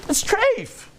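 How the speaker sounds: high-pitched pronouncement, drawn out; mock exclamation. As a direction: call out the ruling high and long. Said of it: "He says".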